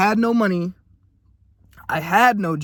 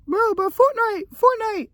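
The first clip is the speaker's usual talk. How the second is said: much higher, slow squeaky mock kid voice; mocking friends; slower than usual. Friends mocking his dreams with Fortnite, squeaky high mock voice. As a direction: whine it like a clueless gamer friend. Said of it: "motherfuckers would always look at me and be like".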